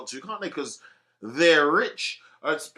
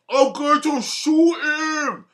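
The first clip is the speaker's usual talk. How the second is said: slow, much higher and louder shouted threat; hammed-up angry shout; mock threat; 10 semitones higher, 8 dB louder, much slower than usual. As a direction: bellow it like a furious boxer. Boxer openly threatening to shoot.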